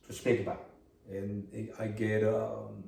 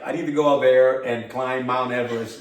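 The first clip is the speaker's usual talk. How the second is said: fired up and louder, ready to take on anything; pumped up; 3 semitones higher, 9 dB louder than usual. Real, not acted. Host inspired by guest, louder and excited. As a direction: say it like you could climb a mountain right now. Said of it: "right now I'm like".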